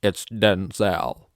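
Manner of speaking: slow, loud, humourless correction; imitating a star's unsmiling correction; dead straight. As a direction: say it slowly with no hint of joking. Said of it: "Looks at me dead straight".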